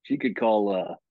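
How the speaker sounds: ordinary talk, nothing marked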